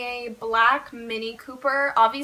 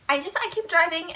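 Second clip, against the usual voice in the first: usual speaking voice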